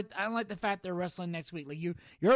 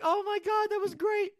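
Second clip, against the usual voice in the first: much higher, delighted squeal; overjoyed reaction to the scene; excited gush; 13 semitones higher than usual. Retold or imitated. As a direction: gush like a thrilled fan. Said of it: "I'm like".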